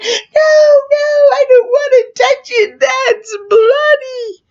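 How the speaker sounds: high, loud wailing refusal; imitated panicked child. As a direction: wail a refusal like a horrified kid. Screaming kid refuses, acted.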